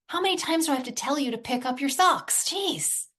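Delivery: high, fed-up nagging mom; nagging parent. Retold or imitated. Mother nagging son about his socks, fed up. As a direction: sigh out the nag like a worn-out mother.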